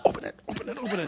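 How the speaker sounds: hushed, urgent imitation of a wife; nudging wife. Wife elbowing her husband in church, urgent whisper. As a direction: whisper it rapidly, insistently. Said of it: "My wife's elbowing me".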